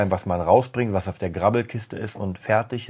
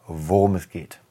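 normal talking voice

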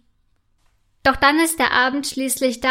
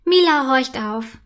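usual speaking voice

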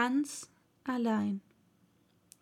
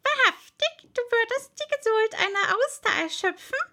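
high, exasperated creature voice; loud-starting, high-pitched saucy retort; cheeky young toad; 11 semitones higher, 5 dB louder than usual. A narrator's reading. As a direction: snipe back in a high, impudent voice. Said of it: "etwas naseweiß".

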